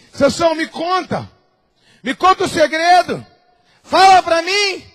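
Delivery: lightly voiced coaxing woman, slow at the end; pleading Delilah. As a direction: coax the secret out of him. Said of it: "Delilah pleading with Samson". (Dalila insistente, um pouco mais aguda)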